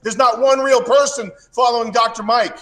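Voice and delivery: high-pitched, worked-up tirade; indignant rant building up; heated rant. Real, not acted. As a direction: rant in disbelief, pitched well up. Worked-up rant mocking a rival.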